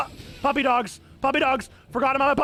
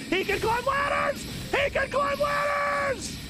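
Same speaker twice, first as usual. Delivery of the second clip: high amazed yell; real delight; delighted shout. Real, not acted. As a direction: yell in gleeful disbelief. Delighted shock in game.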